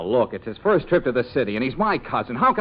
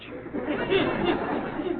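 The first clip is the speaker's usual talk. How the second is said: embarrassed high giggle; flustered high-pitched laugh; nervous laugh; 12 semitones higher, 6 dB quieter than usual. Acted. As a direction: laugh to cover a slip. Caught in awkward phone call.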